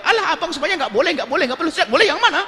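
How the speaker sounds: high, laughing complaint to her husband; a wife whining back, half laughing; whiny wife. Acted. (istri merengek sambil tertawa dengan nada tinggi)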